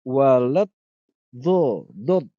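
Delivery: loud, heavy emphatic dho in Walad dho. Shown as a demonstration. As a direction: push the heavy dh sound. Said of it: "Jadi bukan walad dolin".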